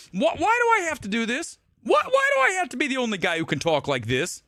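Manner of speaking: high-pitched incredulous complaint; real exasperation, voice pushed up high; exasperated. Real, not acted. Exasperated rant at other commentators.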